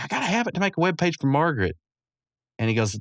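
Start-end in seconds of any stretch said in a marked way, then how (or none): 0.0-1.8 high, quick mock plea; pleading. Mordecai arguing for the computer, quick high pleading. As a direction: plead fast in a raised voice.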